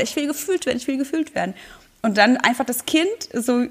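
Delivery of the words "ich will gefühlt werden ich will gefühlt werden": high childlike insistence, mild mock shout; mock louder child. The feeling insisting louder to be felt, high childlike mock shout. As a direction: press it like a kid who won't quit. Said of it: "dann schreit's lauter".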